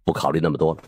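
plain reading voice

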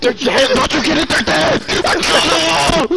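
high loud unhinged villain; dramatic villain. Acted. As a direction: ham it up like a deranged villain.

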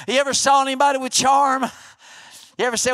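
ordinary talk, nothing marked

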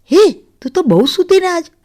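high, lightly teasing greeting; teasing husband. Acted. Husband teases wife waking late, playful.

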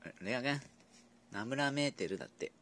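usual speaking voice

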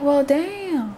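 high, put-on voice, dry and wry; wry resignation. Kid reacting to the beating, high mock deadpan. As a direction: shrug it off in a silly voice.

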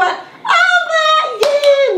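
high, bright cheer of over; excited shout. Real, not acted. Therapist cheering the toy jump for the child, excitedly. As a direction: cheer the toy's big jump.

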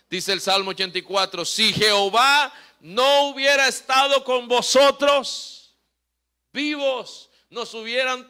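loud, driving pulpit preaching; sermon shouted with fervor; full-force preaching. Acted. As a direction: preach it out hard to the congregation.

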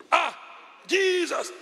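sudden high shout of fright; startled cry. Retold or imitated. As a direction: yelp it as the wheel locks. Sudden car scare story.

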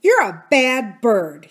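loud, slow telling-off; stern. A narrator's reading. Aunt Polly with no kind word for Kiki, stern. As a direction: scold slowly and firmly. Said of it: "she said sternly to the parrot".

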